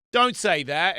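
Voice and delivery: imitating a parent telling a kid off; parent scolding. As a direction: snap it like a fed-up parent. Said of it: "the parents would say".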